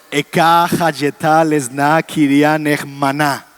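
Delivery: Eka hayeta lesna kirian ejmaná read slowly as Hebrew; slow, measured Hebrew chant of Eka hayeta; Hebrew recitation. Shown as a demonstration. Teacher reads Isaiah in Hebrew for the class, slow and careful. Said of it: "¿Cuál es la característica?".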